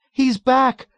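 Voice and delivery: very high, loud hysterical cry; scream. A narrator's reading. Nurse sinking into her colleague's arms, screaming. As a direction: scream it in distress. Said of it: "Nurse Kramer screamed".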